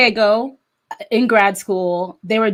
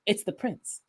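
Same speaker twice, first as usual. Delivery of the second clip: hushed awe; 16 dB quieter than usual. Retold or imitated. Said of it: "they don't understand that I'm like".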